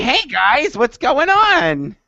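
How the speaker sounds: imitating the gym teacher. Imitating his old gym teacher to friends, high chirpy voice. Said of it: "She's like".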